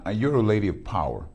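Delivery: ordinary talk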